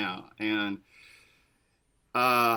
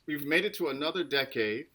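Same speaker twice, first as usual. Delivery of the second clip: usual speaking voice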